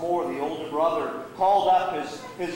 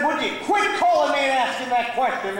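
raised, irritated retort; snapping; 7 semitones higher, 4 dB louder than usual. Acted. Younger brother on the phone, snapping at repeated calls. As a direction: snap at him to stop. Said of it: "his brother snapped at him".

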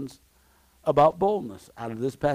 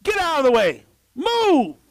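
much higher, commanding shout; shouted command to the mountain; 10 semitones higher, 3 dB louder than usual. Shown as a demonstration. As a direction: order the mountain out of the way at full voice. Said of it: "If you say to this mountain".

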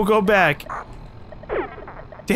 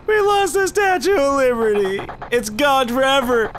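dismayed high shouting; 8 semitones higher than usual. Real, not acted. Losing the Statue of Liberty.

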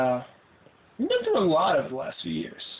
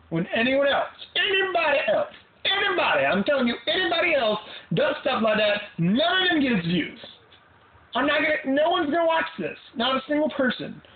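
high, fired-up complaining; high exasperated rant; worked-up rant; 9 semitones higher than usual. Real, not acted. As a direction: rant it higher and faster in pitch. Ranting about views, high pitched.

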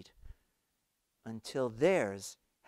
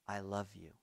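much softer, lower, simply offered; hushed tender; 5 semitones lower, 13 dB quieter than usual. A narrator's reading. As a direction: let it fall quietly and gently. Jesus says I love you.

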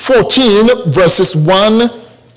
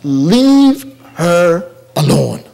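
Jesus's rebuke; 4 semitones lower, slower than usual. Acted. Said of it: "But Jesus said".

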